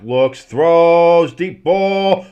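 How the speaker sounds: loud, high, stretched play-by-play; excited pass call. Real, not acted. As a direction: build with the ball in the air. Live call of long pass.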